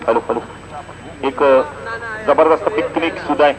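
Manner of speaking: ordinary talk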